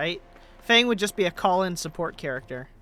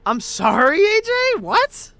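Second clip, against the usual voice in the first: shocked; 11 semitones higher, 6 dB louder than usual. Real, not acted. Shocked at boss fight.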